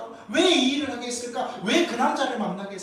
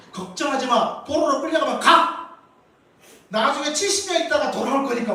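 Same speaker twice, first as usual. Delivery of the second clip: louder and higher carefree mock; mock breezy prophet; 5 semitones higher, 8 dB louder than usual. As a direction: toss off the reassurance, mocking. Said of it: "이런 말들을 좋아하지". (가볍게 비꼬는 거짓 위로)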